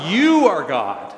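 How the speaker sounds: "you" stretched out loud and slow; stress on YOU. Acted. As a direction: hold "you" long, then fall away on "are God". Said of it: "why the emphasis on the word".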